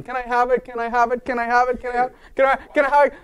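put-on high pleading kid voice, asking over and over; whiny child begging. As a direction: beg like a small child who wants the toy.

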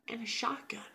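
soft, high, suspenseful reveal; hushed reveal. Retold or imitated. Revealing what the old lady holds, hushed reveal. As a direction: let the weapon land in a hush. Ominous reveal of her weapon.

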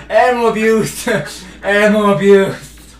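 loud laughing shout; laughing outcry. Real, not acted. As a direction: crack up while yelling it. Laughing at hitting dog.

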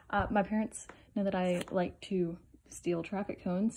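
hushed confiding whisper. Real, not acted. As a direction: let the secret slip in a whisper. Secret confession whispered to camera.

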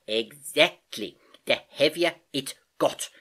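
emphatic agreement. Acted. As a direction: stretch it out, triumphantly approving. Uncle delightedly finishes her sentence.

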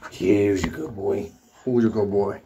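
low, drawn-out pet talk; doting dog praise. Real, not acted. Owner asking the dog who's good, low doting drawl.

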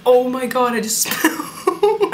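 real laughing dismay, word stretched out; laughing shock. Real, not acted. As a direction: break into a laugh as it spills. Spills coffee mid-sentence.

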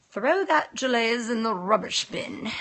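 lightly put-on British accent. Shown as a demonstration. Showing Barry the practised accent, British accent. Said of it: "Good Brit accent".

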